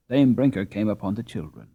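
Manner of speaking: usual speaking voice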